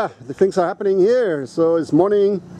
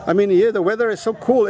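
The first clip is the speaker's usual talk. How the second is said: usual speaking voice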